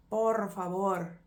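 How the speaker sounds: Por favor slow, Spanish r; Spanish please. Shown as a demonstration.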